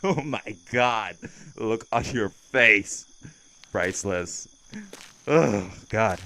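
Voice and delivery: slow, loud words coming out of a laugh; gleeful mockery. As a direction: recover from laughing at their face. Cracking up at listener's frightened face.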